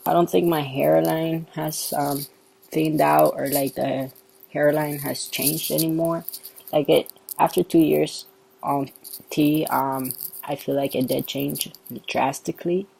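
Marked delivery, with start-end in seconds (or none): none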